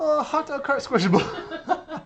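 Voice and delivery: high, loud, drawn-out laughing coo; laughing squeal. Real, not acted. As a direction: squeal it slowly through laughter. Cooing over hot dog cart drawing.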